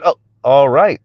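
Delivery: usual speaking voice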